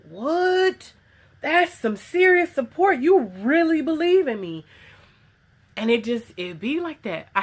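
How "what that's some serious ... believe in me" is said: surprised. Retold or imitated. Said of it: "And I'm like".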